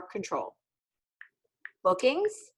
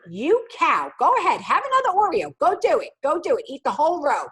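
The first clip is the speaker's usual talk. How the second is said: higher, louder taunting self-talk; mock mean inner voice; 5 semitones higher, 4 dB louder than usual. Inner critic taunting her about Oreos, higher and louder. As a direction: taunt yourself like a bully.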